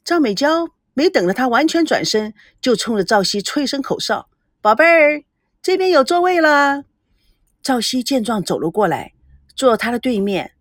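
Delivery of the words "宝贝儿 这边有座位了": high, louder call across the room; a cheeky call across the room. Acted. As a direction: sing out to him playfully. Calling across the restaurant.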